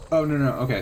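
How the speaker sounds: ordinary talk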